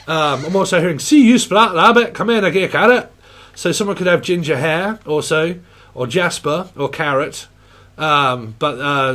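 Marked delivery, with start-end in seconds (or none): 1.0-3.1 louder, higher Scottish greeting; mock Scottish accent. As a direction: hail a mate in a Scots voice.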